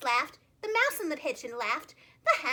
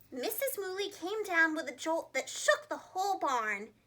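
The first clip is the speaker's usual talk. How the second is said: plain reading voice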